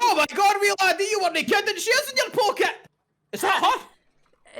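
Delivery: a shopkeeper thrilled to see her; excited shopkeeper. Acted. Shopkeeper amazed to see the goddess, high and loud.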